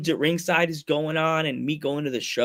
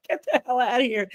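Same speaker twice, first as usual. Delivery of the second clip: higher-pitched shooing off; mock irritation; 8 semitones higher than usual. James chasing the fan from the deli, annoyed. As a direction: imitate an annoyed coworker's brush-off.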